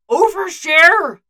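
loud, high, drawn-out protest; yelling. A narrator's reading. Bucky yelling at Clint as the video feed ends. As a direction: yell it out in a long protest. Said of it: "Bucky yells at him".

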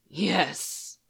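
a ragged, breathless yes. A narrator's reading. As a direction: let the word fray as it leaves.

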